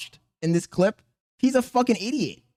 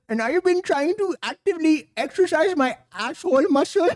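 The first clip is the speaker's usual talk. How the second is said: higher-pitched mocking Indian accent; 6 semitones higher than usual. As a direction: parrot him in a put-on Indian accent. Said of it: "Oh, he said".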